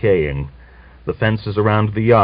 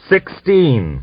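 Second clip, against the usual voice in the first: roared; 7 semitones higher than usual. Acted. Christonia settling the punishment with a roar. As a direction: shout it out as the verdict. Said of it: "Christonia roared".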